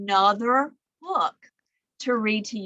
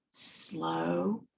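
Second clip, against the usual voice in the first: drawn out and lower; slow; 6 semitones lower, 7 dB quieter than usual. Shown as a demonstration. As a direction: say it long and lazy. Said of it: "one named Slow".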